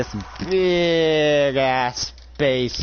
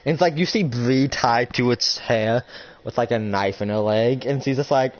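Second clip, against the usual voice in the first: normal talking voice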